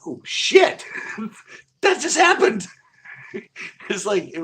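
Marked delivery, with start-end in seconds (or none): 0.0-2.6 very high, loud mock shriek with a laugh; shocked realization. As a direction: squeal it out as it suddenly dawns on you. Acting out his sudden delayed realization.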